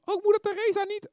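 very high, loud squeaky question; put-on questioning voice. Preacher voicing an objection about Mother Teresa, put-on high voice. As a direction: pipe up loudly, far above your own voice.

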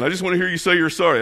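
high, fast, loud retold demand; imitated angry yelling. Enraged driver turning back on the husband, mock angry yell. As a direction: mimic an irate driver insisting, rushed and loud. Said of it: "he's yelling at her".

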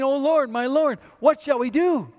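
calling out loud in alarm; servant's panicked loud plea; frightened cry. Acted. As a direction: cry out to the Lord in fear. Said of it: "the servant cries out".

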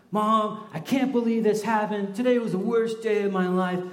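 high, loud mock tantrum from a child; hammed-up angry kid venting at mom; kid ranting. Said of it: "I just let her have it".

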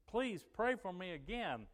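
high, loud, drawn-out plea; retold desperate request; pleading. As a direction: beg earnestly in her voice. Retold woman begging for prayer.

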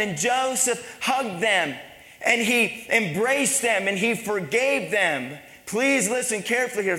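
impassioned preaching. Real, not acted. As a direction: press the story home to the congregation. Preaches forgiveness at full force.

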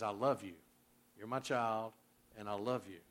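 low and soft; quiet, sincere affection; tender assurance. Acted. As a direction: speak it gently, as a loving father. Preacher voices God's tender assurance.

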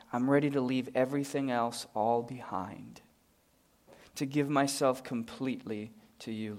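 soft, earnest prayer voice; closing prayer. Acted. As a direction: pray quietly over the congregation.

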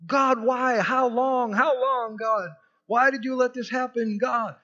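loud, high anguished shout at God; a psalmist shouting his complaint, high and strained; complaining cry. Acted. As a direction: cry out the lament, raw and loud. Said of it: "complaining Psalms".

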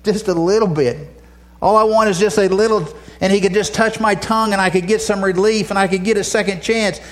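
high-pitched acted begging; desperate appeal, voiced by a preacher; pleading for mercy. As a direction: plead for the smallest relief. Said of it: "he's crying out for mercy".

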